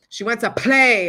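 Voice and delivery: "play" hit with a loud, popping P; popped P. Shown as a demonstration. Showing a mic pop, a punchy P. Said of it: "the pops".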